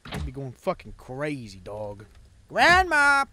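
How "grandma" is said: shout. Real, not acted. Calling out to grandma.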